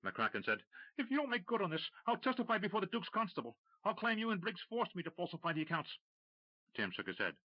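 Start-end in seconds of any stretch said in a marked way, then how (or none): 1.0-6.0 desperate threat. Acted. McCracken threatening Tim, high and desperate. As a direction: threaten him in panic.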